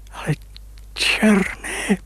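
put-on suddenly seeing his young face; astonished cry. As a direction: cry out in disbelief. Recognizes himself in the water.

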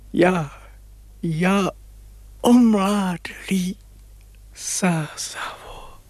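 slow, breathless whisper; whispering to the river in amazement; awed whisper. Acted. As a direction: whisper it slowly in wonder.

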